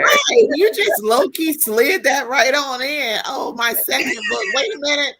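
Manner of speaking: high, lively playful ribbing; playful teasing. Real, not acted. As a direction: rib her playfully, excited.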